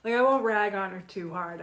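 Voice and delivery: ordinary talk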